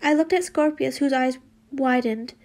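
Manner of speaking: ordinary delivery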